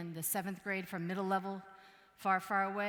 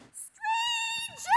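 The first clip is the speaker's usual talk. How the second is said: put-on shrill, very high scream like a terrified kid; child's scream; 12 dB louder than usual. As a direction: scream it at the top of your lungs. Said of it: "He started screaming at the top of his lungs".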